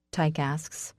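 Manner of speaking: ordinary delivery, nothing marked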